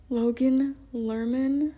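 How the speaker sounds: ordinary delivery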